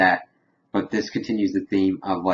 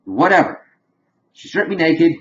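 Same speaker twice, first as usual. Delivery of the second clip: higher-pitched mock grievance; acted indignation; 8 semitones higher, 3 dB louder than usual. Retelling a character's complaint about being stripped, indignant.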